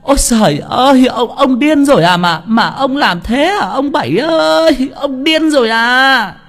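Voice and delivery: very high, drawn-out wailing yell; hollering for the village. A narrator's reading. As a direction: cry out loud and slow, stretching each call. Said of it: "la làng la xóm". (la làng la xóm, gào kéo dài)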